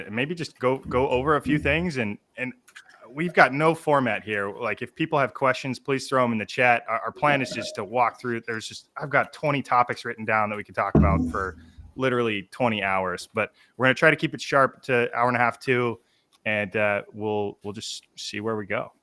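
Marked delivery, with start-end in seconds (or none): none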